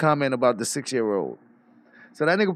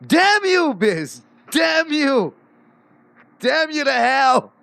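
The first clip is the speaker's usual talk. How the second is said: hammed-up high curse; 9 semitones higher, much slower than usual. Friend on the stream cursing Biz in a hammed-up high voice. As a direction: ham it up like a melodrama villain. Joking echo of exasperation.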